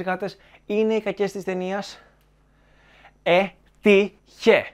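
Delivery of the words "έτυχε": ΈΤΥΧΕ loud and drawn out syllable by syllable; έτυχε spelled out in loud, separate beats; emphatic punchline. Real, not acted. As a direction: stretch έτυχε slowly, hitting each syllable hard. Exasperated punchline to the argument.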